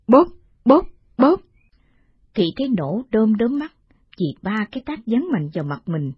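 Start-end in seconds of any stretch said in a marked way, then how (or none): 0.1-1.4 imitated slaps. Slap sounds imitated.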